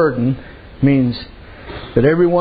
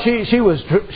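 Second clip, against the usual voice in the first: ordinary talk, nothing marked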